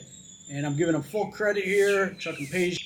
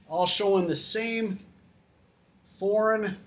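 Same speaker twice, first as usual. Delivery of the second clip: ordinary talk, nothing marked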